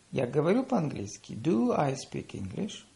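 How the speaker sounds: usual speaking voice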